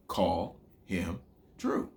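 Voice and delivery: low, separate beats on Call him Drew; syllable by syllable. Shown as a demonstration. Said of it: "break that down into syllables".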